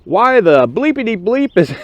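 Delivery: high, loud chewing-out; angry sergeant. Retold or imitated. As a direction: tear into the prospects. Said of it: "he looks at them all and goes".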